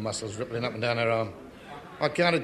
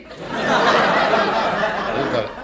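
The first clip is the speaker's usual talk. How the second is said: delighted laughing outburst; 6 semitones higher, 7 dB louder, much slower than usual. Acted. Manager delighted by the plan.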